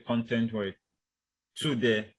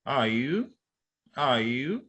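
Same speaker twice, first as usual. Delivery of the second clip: YOU swept upward at the end; rising greeting intonation. Shown as a demonstration. Lecturer showing a simple greeting, rising intonation. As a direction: greet with the pitch climbing on "you". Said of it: "should go up".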